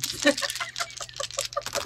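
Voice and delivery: sudden squeaky giggle; high squeal of laughter. Real, not acted. Teased for being lazy, squeals with laughter. Laughs after Spirit scolds her.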